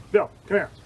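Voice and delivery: loud call across the dojo; imitating a stern coach's call. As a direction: summon him onto the mat. Coach calling him onto the mat.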